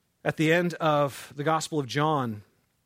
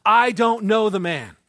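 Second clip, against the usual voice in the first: cursing denial; 5 semitones higher, 9 dB louder, slower than usual. Retold or imitated.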